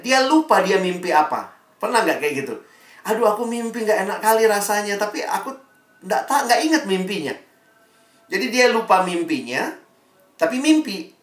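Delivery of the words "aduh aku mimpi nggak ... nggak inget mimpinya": fretful king. Acted. King frets over forgotten dream.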